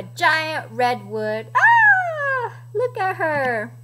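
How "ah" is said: high, loud "ah"; playful shriek of alarm; mock scream. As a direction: cry out as if crashing. Chicken crashes into redwood.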